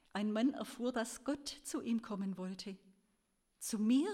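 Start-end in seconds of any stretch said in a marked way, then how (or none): 3.6-4.1 lauter, ungläubiger Aufschrei; erschrockener Ausruf. A narrator's reading. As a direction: ruf es fassungslos heraus. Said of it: "schrie er". (lauter, ungläubiger Ausruf)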